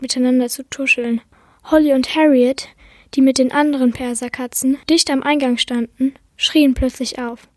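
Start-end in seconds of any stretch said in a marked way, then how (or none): none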